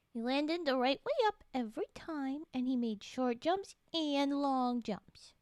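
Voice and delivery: ordinary talk, nothing marked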